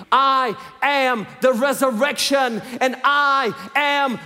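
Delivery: loud, slow, building sermon cry; preaching at full force. Acted. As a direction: proclaim it to the whole church. Preaching climax, rising.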